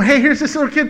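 eager and quick to volunteer; eager offer. Acted. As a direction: offer it up with sudden keenness. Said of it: "it went from".

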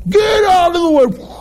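high, forceful mock shout; barging shout. Preacher imagining an angry intruder coming in, mock shout.

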